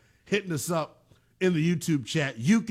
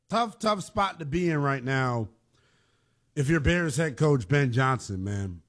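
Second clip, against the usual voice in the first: ordinary delivery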